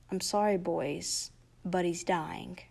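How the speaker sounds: soft, slow, solemn doctor; quiet grave news. Acted. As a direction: break the news gently. Doctor breaks bad news.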